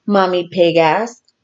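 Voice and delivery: plain reading voice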